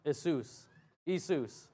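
"Jesus" said as "ee-SOOS"; Greek pronunciation. Shown as a demonstration. Preacher reading out the Greek spelling, "Jesus" as ee-SOOS. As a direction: drop the J in "Jesus" and stress the end. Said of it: "What does that say?".

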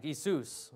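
"Jesus" said the Greek way, "ee-SOOS"; ye sound. Shown as a demonstration. Preacher showing the Greek spelling's sound, "Jesus" as "ee-SOOS". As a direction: open "Jesus" on a Y-like ee, stress the end. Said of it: "with a ye sound".

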